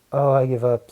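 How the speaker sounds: loud exasperated give-up; giving up. Acted. Wanda gives up on the rot talk, exasperated. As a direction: throw up your hands out loud. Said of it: "said Wanda".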